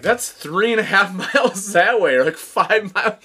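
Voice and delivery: loud, high-pitched disbelief; acted astonishment. Hotel staff telling a lost man the distance, astonished. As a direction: exclaim it, amazed at the distance.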